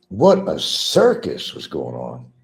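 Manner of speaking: usual speaking voice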